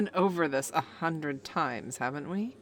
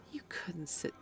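whisper; 9 dB quieter than usual. Acted. Taunting her friend about his punishment in a whisper. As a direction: breathe the threat close. Menacing reminder.

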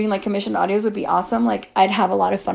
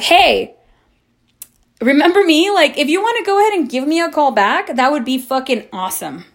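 retold snarky message, pitched up; sarcastic callout; 6 semitones higher than usual. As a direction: call it out with pointed false cheer. Reenacting pointed tweet to ex.

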